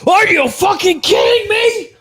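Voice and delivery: high-pitched, loud indignant tirade; hammed-up shrieking outrage; outraged yelling. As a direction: act out a furious protest, yelling way up high. Acted outrage at no tip.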